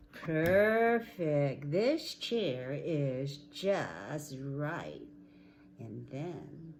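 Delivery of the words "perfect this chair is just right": loud, drawn-out approval; delighted Goldilocks. Acted. Goldilocks trying Baby Bear's chair, delighted. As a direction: savour it loudly and slowly.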